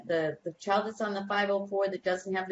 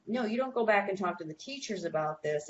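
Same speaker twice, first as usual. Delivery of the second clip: normal talking voice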